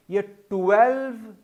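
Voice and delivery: ट्वेल्व said slowly, the ठ flowing straight into the w; careful twelve. Shown as a demonstration. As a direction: stretch out ट्वेल्व, t gliding into w. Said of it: "ध्यान रखने वाली हैं".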